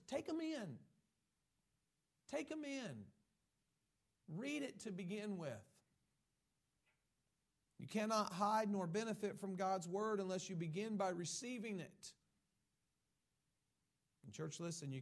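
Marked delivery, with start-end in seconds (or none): 0.0-5.6 urgent exhortation. Real, not acted. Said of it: "exhorting the church". 7.8-12.1 loud, climactic declaration; preaching at full force. Real, not acted. Preacher to church, declaring loudly. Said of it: "climactic preaching point".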